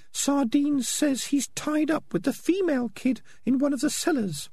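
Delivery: squeaky falsetto character voice; prim Peaches. Acted. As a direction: speak high up in falsetto, measured.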